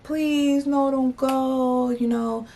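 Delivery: high, drawn-out pleading guys; imitating guys begging them to stay; mock begging. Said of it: "they're, like, begging us".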